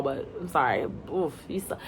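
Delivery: ordinary delivery, nothing marked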